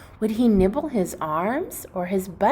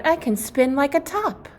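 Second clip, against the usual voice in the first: higher playful boast; excited cry; 7 semitones higher than usual. Acted. The gingerbread boy bragging to the puppies, excitedly. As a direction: brag about spinning. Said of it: "Cookie cried".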